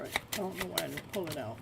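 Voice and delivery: quiet puzzled aside while shuffling cards; puzzled murmur. Real, not acted. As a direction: mutter it, half to yourself. Puzzled the Empress wasn't pulled.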